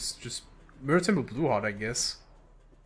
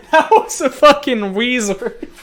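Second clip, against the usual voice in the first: loud, slow, high, laughing through it; gushing through laughter; laughing hype; 8 semitones higher, 10 dB louder, slower than usual. Real, not acted. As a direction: crack up while praising. Hyped about the play.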